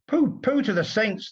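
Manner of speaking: a hammed-up sneer at the saints; grumbling. A narrator's reading. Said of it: "the world was grumbling".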